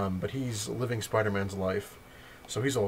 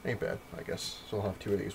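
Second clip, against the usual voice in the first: ordinary delivery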